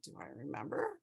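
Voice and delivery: as if that could ever be forgotten; mock incredulous. Mother answering her son's question about his fall, low and incredulous. Mom's incredulous reply to son.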